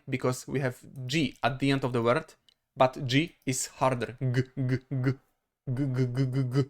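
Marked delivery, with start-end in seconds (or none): 4.2-6.6 "g" as a string of short throaty bursts; hard voiced g. Shown as a demonstration. Teacher showing G is harder, voiced bursts. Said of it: "We use our throat".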